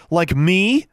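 high, loud affronted question; offended outburst pitched high; voice rising. A narrator's reading. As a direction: climb sharply in indignation. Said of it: "her voice rising".

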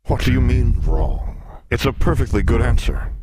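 affronted, booming protest; indignant sphinx. Acted. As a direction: object loudly in a beast's voice. Sphinx disputes being wrong.

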